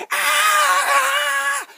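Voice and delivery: long high screaming roar; imitated monster roar. Godzilla revealed to the audience, imitated screaming roar. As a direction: let out a loud, high monster screech.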